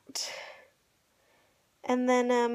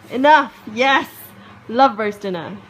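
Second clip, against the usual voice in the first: cheering; 12 dB louder than usual. Real, not acted. Cheering for roast dinner.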